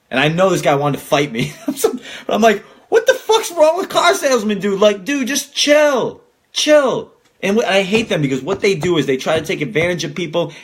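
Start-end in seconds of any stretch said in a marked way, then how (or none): 2.9-7.1 high, slow exasperated plea to chill; high, drawn-out "just chill". Retold or imitated. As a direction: beg him to calm down, pitched way up. Exasperated rant at car salesmen.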